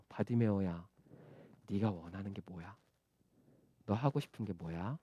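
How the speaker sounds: very soft, low, tender question; Jesus gently asking. Acted. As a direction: ask quietly and kindly, with space between phrases. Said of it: "예수님이 그걸 물어보시는 거예요".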